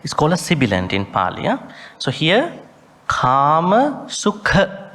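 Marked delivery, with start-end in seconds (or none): 3.1-4.9 "Kama Sukha" slow, with a puff of breath on the kh; "Kama Sukha" drawn out, emphasising the aspirate; aspirated kh. Shown as a demonstration. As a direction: lean on the kh of "Sukha". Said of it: "Whenever you see H, that means you have to".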